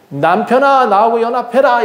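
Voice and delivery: high, playful call to a husband; mock plea. Leah appeals to her husband, high mock plea. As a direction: plead high, half laughing.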